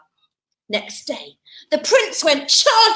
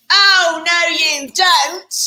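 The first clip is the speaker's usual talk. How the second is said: louder, slow oh no you don't; mock demand; 5 dB louder, slower than usual. Ugly sister stops the fleeing prince, bossy. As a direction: block his escape, hammed up.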